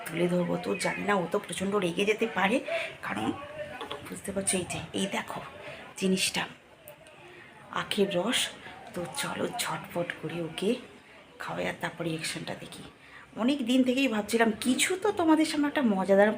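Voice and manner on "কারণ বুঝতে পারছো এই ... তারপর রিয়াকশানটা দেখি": hushed, much softer voice; speaking softly. Real, not acted. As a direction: keep it low so she won't hear. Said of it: "তাই অনেকটাই আস্তে কথা বলছে".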